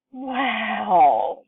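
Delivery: put-on boy shouting in wonder; excited shout. A narrator's reading. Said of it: "he was shouting".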